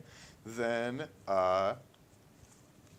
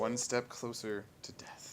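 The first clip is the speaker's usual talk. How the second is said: ominous whisper; 3 semitones higher than usual. Acted. GM ominous aside.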